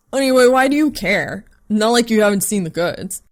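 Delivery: loud, careless guy voice; brash roommate. Acted. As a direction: toss it off loudly. Brash roommate talking loudly.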